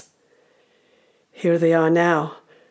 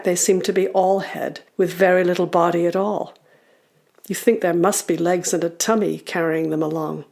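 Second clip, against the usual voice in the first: ordinary delivery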